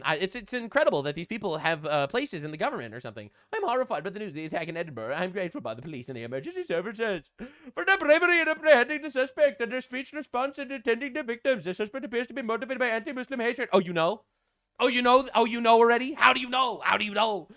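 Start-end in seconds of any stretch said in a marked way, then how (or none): 3.5-13.7 mock minister voice. Mocking Shabana Mahmood's statement in a put-on voice. 13.7-17.5 building louder mock surprise; sarcastic. Real, not acted. Mocking the minister's quick conclusion, sarcastic surprise building louder.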